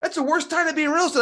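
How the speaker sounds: fast, high, scoffing jab; curmudgeon scoffing. Retold or imitated. Grumpy investor friend dismissing real estate, a quick high scoff. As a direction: scoff at the whole idea, quickly. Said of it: "curmudgeon-y at times".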